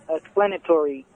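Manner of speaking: ordinary delivery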